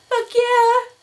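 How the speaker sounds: high, loud whoop of triumph; excited cheer. Real, not acted. Vlogger thrilled about free candy, excited cheer.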